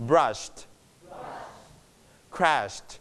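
"brushed", "crashed", each ending in a soft t; quiet t closing "brushed" and "crashed"; light final t. Shown as a demonstration. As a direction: model "brushed" and "crashed" as single syllables. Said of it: "volume down ลดเสียงเธอมาเบาๆ".

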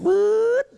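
sudden loud vocal whoosh-buzz; imitated swooping buzz. Shown as a demonstration. Guide imitating the bat's final swoop on prey, loud mock buzz. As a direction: make the swoop sound as the bat dives. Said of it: "เสียงมันจะ".

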